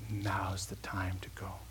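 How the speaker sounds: softly whispered release; a hushed, tender go-ahead. Acted. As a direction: breathe it out, barely voiced. Father's word to Jesus.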